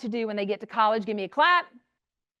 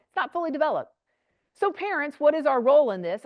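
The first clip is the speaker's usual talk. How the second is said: normal talking voice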